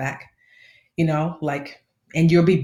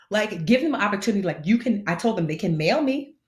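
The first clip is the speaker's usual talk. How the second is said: normal talking voice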